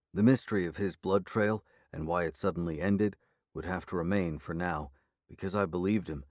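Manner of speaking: ordinary delivery, nothing marked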